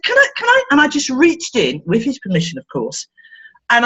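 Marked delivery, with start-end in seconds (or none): 0.0-0.6 playful high ask. Retold or imitated. Therapist asks child playfully.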